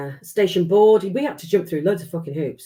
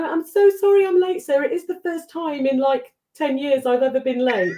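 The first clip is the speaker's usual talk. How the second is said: high, sing-song fake apology; mock apology; 8 semitones higher than usual. Arriving late to the boss with McMuffins, high mock-apologetic. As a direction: ham up being sorry.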